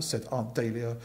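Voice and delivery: ordinary delivery, nothing marked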